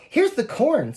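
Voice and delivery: put-on lifted, eager little girl's line; bright child voice. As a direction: say it like an excited kid. Child character voiced in story.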